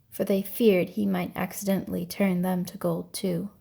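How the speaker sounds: ordinary delivery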